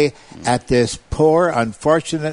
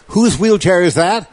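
preacher's challenge; 7 semitones higher, 5 dB louder than usual. Retold or imitated. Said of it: "he would say something like".

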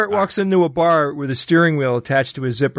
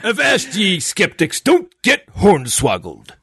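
pirate voice. Pretend. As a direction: warn the crew like an old buccaneer. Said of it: "Talk Like a Pirate Day".

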